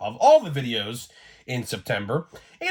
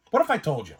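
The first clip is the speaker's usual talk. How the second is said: normal talking voice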